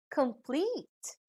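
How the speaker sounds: "complete" softly, emphasis on PLETE; stress on second syllable. Shown as a demonstration. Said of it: "put the stress on the second syllable".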